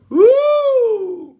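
high, loud preacher whoop. Real, not acted. Preacher whooping to fire up the congregation, high and loud. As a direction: let out a high excited woo.

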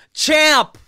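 loud high call of champ, imitating a stranger; imitating a shout. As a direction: bellow champ across the store. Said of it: "he goes".

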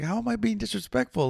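high, hushed protest of disbelief; mock-wounded, high and small; retold shock. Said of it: "you could tell Dennis is, like, legitly, like, kinda shocked".

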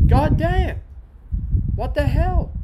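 high, loud what-the-hell outcry; loud disbelief. Retold or imitated. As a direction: yell it at the screen in disbelief. Reacting to the film ending.

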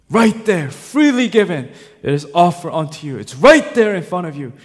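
loud, high, urgent exhortation; impassioned pulpit voice, punching key words; full-force preaching. Real, not acted. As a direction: press every condition on the congregation.

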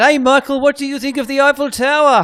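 high, loud mock French reporter; attempted French accent. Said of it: "that's your French accent".

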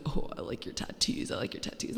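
hushed, voiceless mock parroting; mocking mimicry. Podcaster mimicking everyone who compliments tattoos, mocking hushed voice. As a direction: parrot it under your breath.

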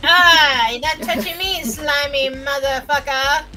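normal talking voice

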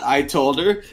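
ordinary talk

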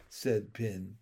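usual speaking voice

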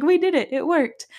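overjoyed shriek at good news; thrilled squeal. Retold or imitated. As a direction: squeal it like you can't believe it worked. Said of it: "so excited at this point".

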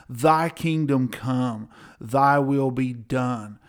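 slow, measured reading of scripture; slow recitation. Shown as a demonstration. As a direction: lay each phrase of scripture down slowly. Reciting the Lord's Prayer.

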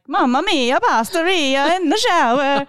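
put-on playful Italian accent over the shower mishap; mock Italian. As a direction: ham up a mock Italian chef. Mock Italian quip about marinara.

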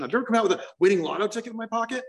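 ordinary talk, nothing marked

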